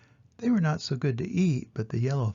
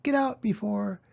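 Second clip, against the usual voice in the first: high, angry shout; 8 semitones higher than usual. Acted. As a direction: drive the horse out with a yell. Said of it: "shouted the farmer".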